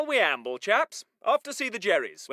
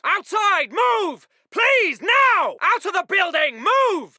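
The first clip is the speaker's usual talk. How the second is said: raised, insistent officer's command; urgent shouted order; slower than usual. Acted. Officer hurrying chaps out of the building, shouted.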